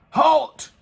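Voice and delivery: high, loud stop command; cried. A narrator's reading. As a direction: stop the goblin in his tracks. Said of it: "cried the knights".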